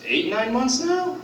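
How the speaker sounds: normal talking voice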